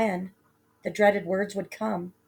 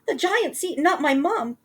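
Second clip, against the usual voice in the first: put-on high, loud boy fading off; excited cry; 8 semitones higher, 4 dB louder than usual. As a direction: cry out, then trail to a smirk. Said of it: "Jack cried".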